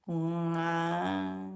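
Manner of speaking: soft wow, lips over teeth. Shown as a demonstration. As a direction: catlike wow through covered lips. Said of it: "it sounds like a cat a little bit".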